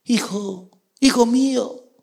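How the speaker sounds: high, halting fatherly plea; hammed-up yearning father; emotional Saul. Saul calls his son, acted.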